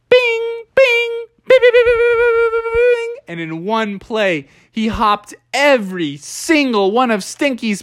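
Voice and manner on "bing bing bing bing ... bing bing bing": high bing bing chimes; ping each hop up high; bing sound effects. Acted. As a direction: checker piece hops as high bings. Hopping pieces sound effect.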